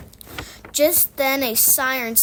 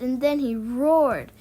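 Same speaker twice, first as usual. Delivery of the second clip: usual speaking voice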